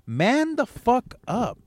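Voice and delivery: slow, high drill-sergeant order; high slow command. Real, not acted. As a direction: bark it slowly from up high. Ordering listeners.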